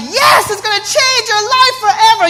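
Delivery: high, eager echo of a customer; mock excited audience. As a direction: imitate a fan swept up in excitement. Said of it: "feeding off of my excitement".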